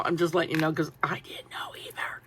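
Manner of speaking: unvoiced confiding whisper. Real, not acted. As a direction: share it like a secret. Confiding aside to viewers.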